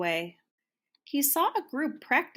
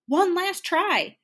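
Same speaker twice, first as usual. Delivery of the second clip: high, slow, hopeful; one last brave go, lifted high; hopeful resolve; 10 semitones higher, 5 dB louder, slower than usual. A narrator's reading. Mac decides on one final try, hopeful and high.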